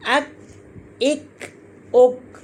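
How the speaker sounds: "अक, एक, उक" with a light k held shut; "अक, एक, उक" ending in a closed k; k without bounce. Shown as a demonstration. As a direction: stop each k of "अक, एक, उक" without echo. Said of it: "यहाँ दब जाएगी".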